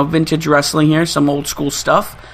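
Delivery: usual speaking voice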